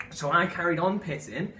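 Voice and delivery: usual speaking voice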